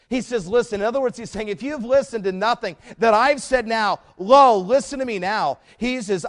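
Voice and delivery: loud, driving sermon delivery; full preaching. Acted. Pastor urging his congregation to listen, loud and insistent. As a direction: preach it hard, pressing every phrase.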